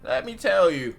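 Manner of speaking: put-on high, loud old granny; grandma voice. An old granny at the door, high and croaky. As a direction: croon it like a sweet old granny. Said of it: "My grandma voice kinda mid".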